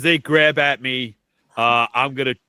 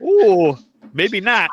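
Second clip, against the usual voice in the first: mock caution; 7 semitones higher, 6 dB louder, slower than usual. Mocking reaction.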